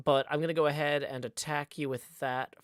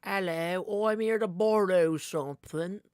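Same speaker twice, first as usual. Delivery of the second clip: higher, playful put-on Korean accent; cheerful mock Korean accent for a card character; hammed-up Korean voice; 4 semitones higher, 3 dB louder, slower than usual. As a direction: mimic a Korean accent, pitched up and cheeky. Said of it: "the second one was my best Korean voice".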